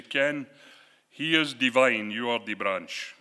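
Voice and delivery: lightly attempted Jamaican accent, loud and emphatic; Marcus, Jamaican accent. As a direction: imitate a Jamaican roommate, not quite convincingly. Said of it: "say this in his Jamaican accent".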